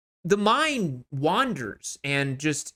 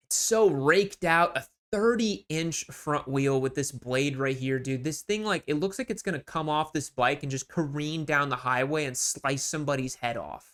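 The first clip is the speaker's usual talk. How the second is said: ordinary talk, nothing marked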